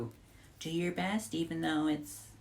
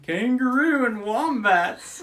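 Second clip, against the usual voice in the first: put-on Australian accent; 9 semitones higher, 8 dB louder, slower than usual. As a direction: caricature an Australian, stretched out. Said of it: "my most insulting was the Australian accent".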